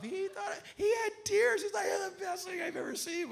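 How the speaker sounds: high, laughing shout; laughing hard. Retold or imitated. [name] telling how Tom laughed till he cried. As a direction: squeal through tears of laughter. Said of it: "Tom was laughing his butt off".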